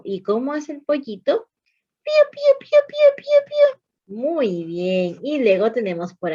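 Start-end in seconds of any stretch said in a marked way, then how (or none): 2.0-3.8 chick peeping. Shown as a demonstration. Teacher voicing chick sound.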